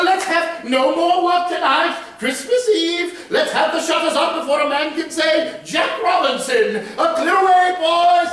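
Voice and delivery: high, loud, merry shouting; imitating jolly Fezziwig. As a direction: call the boys to close up, full of cheer. Scrooge voices Fezziwig's holiday call.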